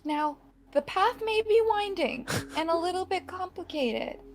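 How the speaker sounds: high, quiet and earnest; soft sincere promise. Acted. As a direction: promise it gently like a fairy. Fairy swears an oath softly.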